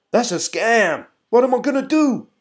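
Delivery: high, loud hammed-up alarm; mock panic. Mimicking everyone crying scam.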